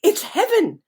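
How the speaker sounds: high, loud burst of wonder; an awestruck ant seeing a new world; excited ant. Acted. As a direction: gasp it out like discovering heaven. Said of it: "and thinks".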